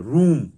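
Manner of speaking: रूम with a clear, loud "oo" vowel; teacher's model रूम, "oo" in the middle; "oo" sound. Shown as a demonstration. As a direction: lean on the "oo" of रूम. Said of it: "वो हर्षऊ की धुन है".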